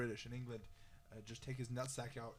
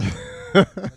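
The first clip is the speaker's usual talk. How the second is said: mock admiring; 6 semitones higher, 21 dB louder than usual. Mock admiring, slow and loud.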